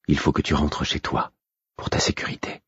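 soufflé, à voix très basse; chuchoté. A narrator's reading. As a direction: chuchote pour ne pas être entendue. Prévient son amant en secret. (chuchotement inquiet)

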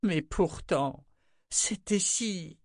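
slow, high, faltering to a stop; tearful. A narrator's reading. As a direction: draw it out, close to tears. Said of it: "Des larmes perlèrent aux yeux".

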